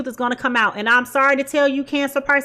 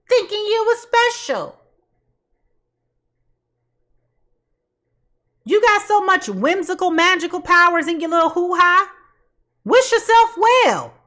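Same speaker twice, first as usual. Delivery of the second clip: mocking sarcasm; 4 semitones higher than usual. Mocking the client's sense of specialness.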